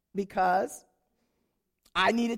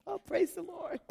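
a hint of a sweet churchy voice, higher; mock pious voice; 9 semitones higher, 4 dB quieter than usual. Mimicking her smiling churchy face at dinner. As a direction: fake cheerful praise. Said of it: "But outside, I was like".

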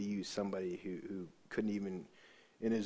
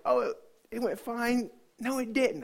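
high-pitched strained reply; imitated high voice about to break; retold cracking composure; 12 semitones higher, 4 dB louder than usual. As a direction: pretend fine, then give way.

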